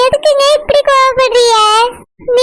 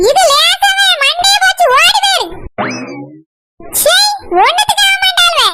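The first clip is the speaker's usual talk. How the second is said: higher-pitched nagging wife voice; lightly voiced scolding woman; bossy wife; 6 semitones higher than usual. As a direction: order her about like a cranky mistress of the house.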